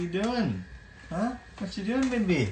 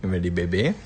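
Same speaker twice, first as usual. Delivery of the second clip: ordinary talk, nothing marked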